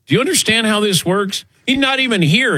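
high exasperated outburst. Real, not acted. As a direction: throw up your hands, high. Mock exasperation at absent Todd.

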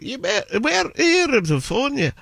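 very high Scots gabble; mock Scottish gibberish in a squeaky voice; unintelligible Scottish accent. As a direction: answer as an incomprehensible Scottish local. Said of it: "as the best Scottish story".

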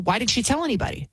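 ordinary delivery

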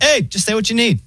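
high, chill AI phone voice; robot voice. Retold or imitated. Mocking the pharmacy AI on the phone, chill high voice. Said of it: "And then it's like".

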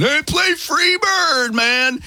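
high-pitched mock heckler's shout, held back in volume; imitated yell. Bar patron yelling a song request at the band, mock yell. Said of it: "anybody would yell out".

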